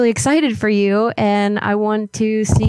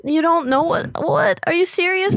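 incredulous; 8 semitones higher than usual. Acted. Caller acting shocked at refusal.

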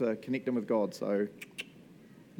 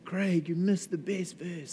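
slow, whiny listener protest; mock complaint; 4 semitones higher, 4 dB quieter, slower than usual. Listener protesting to the preacher, slow and whiny. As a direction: play the listener moaning about a missed verse. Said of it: "you're gonna be like".

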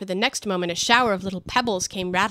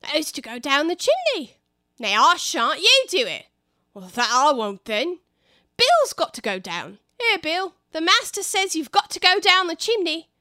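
lightly voiced squabbling labourers; a hint of workmen passing the buck; bickering workers; 6 semitones higher, 5 dB louder than usual. Workers argue over the chimney.

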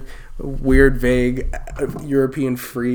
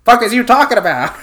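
higher, louder, laughing fake indignation; laughing mock outrage; 10 semitones higher, 10 dB louder than usual. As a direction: bark it out laughing, mock offended.

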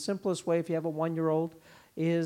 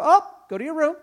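louder, higher "go to your room"; stern dad command; 10 semitones higher, 10 dB louder than usual. Retold or imitated. Dad ordering the toddler to his room, raised voice. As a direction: send the kid off firmly. Parent sending child to room.

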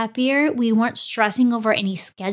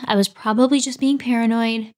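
ordinary delivery

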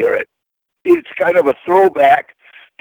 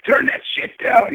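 mock yell.

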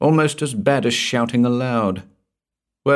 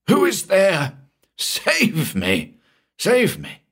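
high, desperate pleas for rescue; tearful crying out; 10 semitones higher, slower than usual. A narrator's reading. Terrified man begging whoever is in the room to save him, high and tearful. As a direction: beg for rescue, close to tears. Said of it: "with tears streaming down his face, cried".

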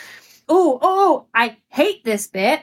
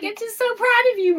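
gushing; 11 semitones higher, faster than usual. Real, not acted. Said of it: "high squeal of fondness".

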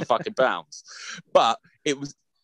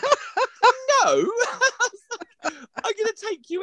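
laughing; much slower than usual. Real, not acted. Mum laughing at the spanking idea.

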